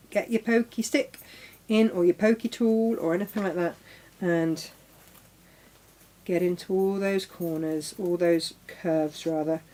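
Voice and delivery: ordinary talk, nothing marked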